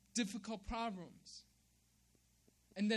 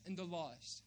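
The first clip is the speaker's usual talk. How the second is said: ordinary talk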